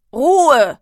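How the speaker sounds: loud, high shout for silence; booming, drawn-out classroom shout; thundering command. A narrator's reading. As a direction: thunder it out like an angry teacher. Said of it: "donnerte er".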